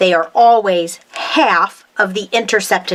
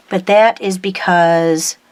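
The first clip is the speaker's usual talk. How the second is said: normal talking voice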